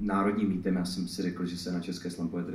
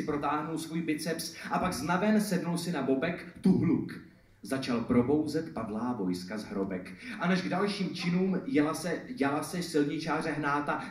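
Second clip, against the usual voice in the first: lively, higher, projected rhyming delivery; slam poetry recital; 8 semitones higher, 9 dB louder than usual. Acted. Poet performing satire on Prague roadworks to an audience, slam poetry recital. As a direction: perform it as a driving slam poem.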